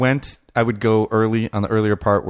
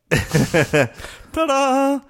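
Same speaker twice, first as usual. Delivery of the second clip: high ta-da; mock fanfare. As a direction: sing out the reveal.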